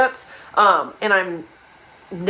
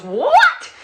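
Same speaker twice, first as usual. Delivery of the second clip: high, startled squeak; a sudden high burst of disbelief; high-pitched shock; shocked "What?"; 5 dB louder than usual. Retold or imitated. Gia seeing the kitchen.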